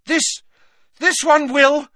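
high, loud, halting gasp; Harry gasping. A narrator's reading. Harry promising relief, gasping desperately. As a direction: gasp it out in broken pieces. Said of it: "gasped Harry".

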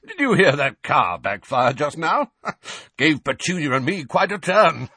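loud, high, forced jolliness with a chuckle; falsely cheery uncle. A narrator's reading. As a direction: call out brightly to cover up, laughing it off. Uncle fakes cheer to watching neighbours.

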